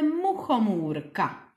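"muchomůrka" spoken syllable by syllable for clapping; clapped syllables. Shown as a demonstration. Teacher clapping out "muchomůrka" for the kids, syllable by syllable. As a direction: split into syllables mu-cho-mů-ra, one clap each. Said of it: "my si zatleskáme".

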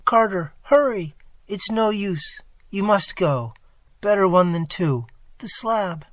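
high, strained, voiced urgency; desperate high-pitched plea; urgent stage whisper. A narrator's reading. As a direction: urge him away, loud and high. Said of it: "his next whisper found me".

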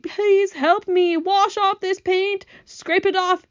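slow, high pleading wail; bawling. A narrator's reading. Centipede begging James to remove the paint, bawling. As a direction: beg tearfully, high and stretched out. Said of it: "bawled the centipede".

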